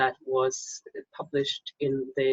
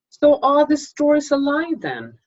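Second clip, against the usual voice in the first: pitched much higher and louder, incredulous; startled question; 11 semitones higher, 6 dB louder than usual. Acted. Narrator pressing Death about near-death stories, high loud incredulous question. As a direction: blurt it out in disbelief.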